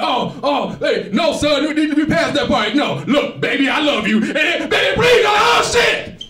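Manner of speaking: high, loud, frantic pleading shout building up; hammed-up bride pleading at the altar. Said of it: "she turned around like".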